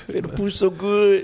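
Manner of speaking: high, slow mock gush; hyped gushing. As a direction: gush over it as a fanboy. Mimicking hype.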